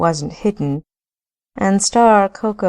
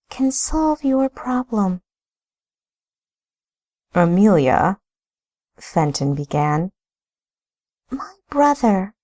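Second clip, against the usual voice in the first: higher, grave woman's voice; Amelia's voice; 6 semitones higher than usual. Acted. Amelia warning her brother-in-law, grave and higher. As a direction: play her grave and firm.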